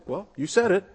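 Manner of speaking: bright, conceding well you said it; agreeing. Shown as a demonstration. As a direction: say it as a nod of agreement. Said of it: "the very same words can mean agreement".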